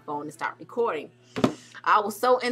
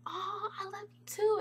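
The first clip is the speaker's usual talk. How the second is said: high, soft reply; imitated star, affectionate; 13 semitones higher, 6 dB quieter than usual. Singer answering a fan's love, imitated high soft coo. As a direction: coo it back to an adoring fan.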